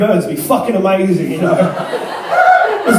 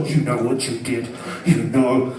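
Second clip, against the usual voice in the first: low, quiet, knowing scold; soft, low reproach as if to a guilty dog; 8 semitones lower, 7 dB quieter than usual. Acted. Owner to a disobedient dog after a mess, low scolding.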